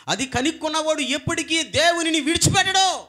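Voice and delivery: loud, high declaration; preaching climax. Real, not acted. Preacher reaching the climax, loud and high. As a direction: proclaim it at full force.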